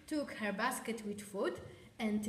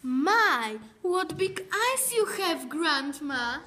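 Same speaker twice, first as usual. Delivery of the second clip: high, loud amazed gasp; wide-eyed astonishment, voice up high; surprised girl; 5 semitones higher, 10 dB louder, slower than usual. A narrator's reading. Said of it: "she said in the surprise".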